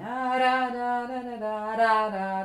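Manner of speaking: tongue each "da" gently like "duh"; duh tonguing. Shown as a demonstration. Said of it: "I'm gonna use a duh tonguing".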